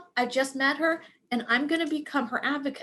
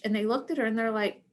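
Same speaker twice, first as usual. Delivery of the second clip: ordinary talk, nothing marked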